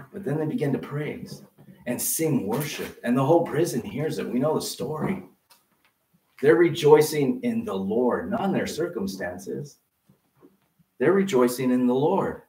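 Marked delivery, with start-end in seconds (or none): none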